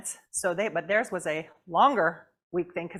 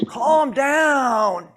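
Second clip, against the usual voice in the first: loud, higher, exasperated command; mock calm down; 5 semitones higher, 10 dB louder than usual. Helper to an angry person, loud calm down. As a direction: bark it like a frazzled helper. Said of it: "And you're like".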